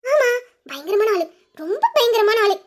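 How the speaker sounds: usual speaking voice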